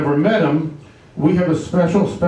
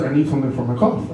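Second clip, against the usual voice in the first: a gruff-sounding customer asking quickly and lower; lower, fast sick customer; 5 semitones lower, faster than usual. Acted. Sick customer asking the pharmacist for help, lower and hurried. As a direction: play the coughing guy, lower and hurried.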